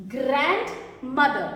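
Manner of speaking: "grandmother" slowly broken into its parts; syllable by syllable. Shown as a demonstration. Teacher breaks a word for spelling class, "grandmother" slow and split. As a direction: sound out "grandmother" piece by piece, slowly.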